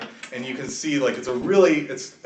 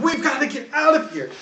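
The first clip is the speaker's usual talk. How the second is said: high, pressing priest's warning; raised-pitch, worried non-player character; urgent priest; 8 semitones higher, 3 dB louder than usual. Acted. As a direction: plead to leave right away. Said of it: "He's like".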